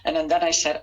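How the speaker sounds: ordinary delivery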